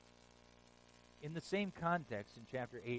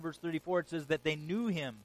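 ordinary talk, nothing marked

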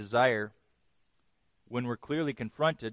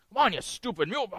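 high, hurried mock exasperation; angry; 8 semitones higher, faster than usual. Balaam beating his donkey, mock angry. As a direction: scold a stubborn animal, pitched up. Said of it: "when we're easily and excessively angered".

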